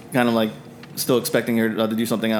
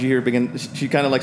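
ordinary delivery

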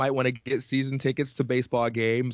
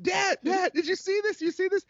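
much higher, breathless eager questions; excited. Retold or imitated. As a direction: burst out like a kid sharing news. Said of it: "And I said".

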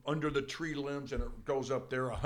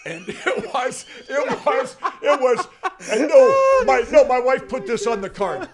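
high, loud words breaking up with laughter; overcome, laughing loudly between starts; laughing to tears; 9 semitones higher, 13 dB louder than usual. Real, not acted. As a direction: struggle to get it out through real tearful laughter. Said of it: "I'm crying".

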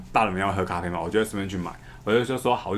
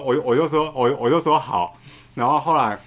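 usual speaking voice

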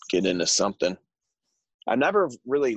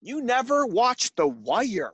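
higher, slow, drawn-out disbelief; 12 semitones higher, slower than usual. Real, not acted. Asking again in disbelief whether his co-host never watched The Wire. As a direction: stretch it out in shock.

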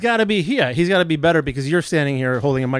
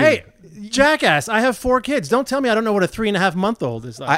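high, loud protest; heated, pitched-up retort; indignant comeback; 6 semitones higher, 3 dB louder than usual. Real, not acted. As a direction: snap back as the offended veteran parent.

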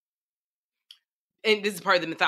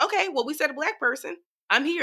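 high bright mock-eager claim; eager hopeful voice; 8 semitones higher than usual. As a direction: play her stepping up. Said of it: "It's like".

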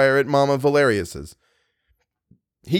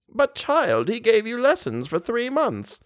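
put-on protesting old mother; 10 semitones higher than usual. Old lady reminds her child of the lessons, high protest. Voices Mamma Valerius.

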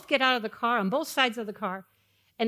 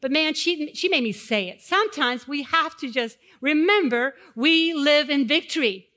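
usual speaking voice

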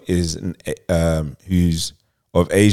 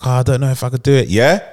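higher, quick retold protest; imitated hesitant reluctance; 6 semitones higher, much faster than usual. Retelling her doubts about killing, mock-hesitant. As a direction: imitate someone wavering, pitched up and hurried. Acting out the woman's reluctant reply.

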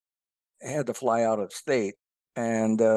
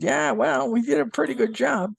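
smug foreman; 12 semitones higher than usual. Retold or imitated. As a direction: play the boastful North Dakota foreman, pitched way up. Cocky foreman in the joke.

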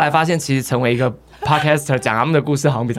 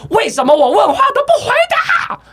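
high, louder angry yell; mock furious roar; 4 dB louder than usual. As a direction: mom roaring at her son. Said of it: "然后怒吼说". (妈妈愤怒地吼叫)

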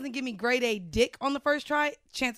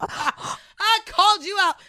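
mock accusing; 7 semitones higher, 5 dB louder, much slower than usual.

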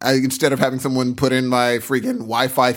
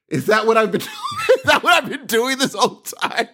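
laughing shout; 8 semitones higher than usual. Acted. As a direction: crack up while shouting it. Realising he is the monkey.

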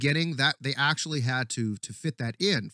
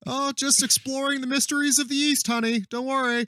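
high, louder, airy excuse; mock innocent wife; 12 semitones higher, 4 dB louder than usual. Wife reassuring her husband in a high, sweet voice. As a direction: play it high and sweetly evasive.

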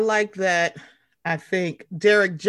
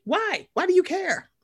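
exasperated why; 9 semitones higher than usual. Real, not acted. Exasperated at Ashley's Google alerts.

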